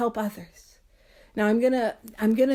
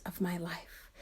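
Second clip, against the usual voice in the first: soft whisper; 6 semitones lower, 16 dB quieter than usual. Real, not acted. Emphatic close of point.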